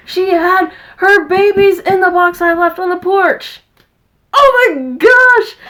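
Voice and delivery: high, loud and amazed; can't believe it, slow Oh my gosh; high-pitched stunned excitement over the kittens; excited disbelief. Real, not acted. Recalling kittens born in box.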